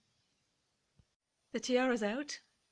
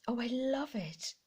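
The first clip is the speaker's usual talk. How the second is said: ordinary talk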